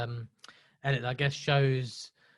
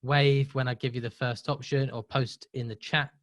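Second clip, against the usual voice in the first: normal talking voice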